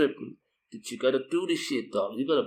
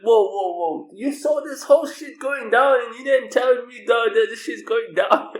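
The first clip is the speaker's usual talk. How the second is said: high, loud startled complaint; mock alarmed protest; 11 semitones higher, 6 dB louder than usual. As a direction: play the shocked friend.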